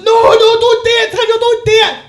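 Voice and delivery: very high, loud, desperate begging; frantic pleading shout. Acted. Stracky begging Trigger not to do it, frantic shout. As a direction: beg at full voice.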